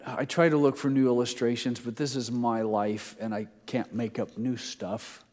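ordinary delivery

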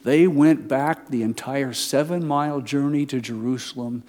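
usual speaking voice

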